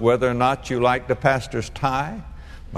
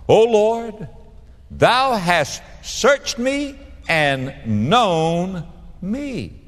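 fervent scripture reading; 6 semitones higher, much slower than usual. Real, not acted.

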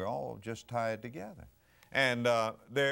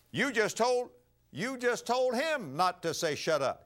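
much higher and louder, mock scolding; indignant mother; 12 semitones higher, 4 dB louder than usual. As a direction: catch the father out, shrill with outrage. Mother scolds father in story.

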